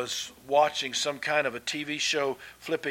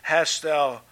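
ordinary delivery

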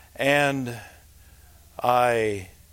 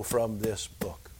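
hushed whisper; 4 semitones lower, 8 dB quieter, slower than usual. Acted. Reverent close.

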